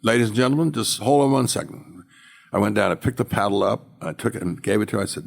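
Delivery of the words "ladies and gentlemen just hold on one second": lightly acted announcement, raised pitch; mock call for the room to wait; auctioneer addressing room. Auctioneer addressing the saleroom, retold.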